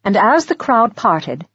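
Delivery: usual speaking voice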